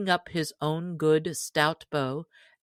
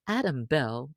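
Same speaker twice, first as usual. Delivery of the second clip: usual speaking voice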